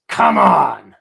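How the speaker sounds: a loud, driving "Come on!"; rally them hard, full force; fierce shout. Shown as a demonstration. Teacher voicing the sword's outward push. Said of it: "then what I'm saying is".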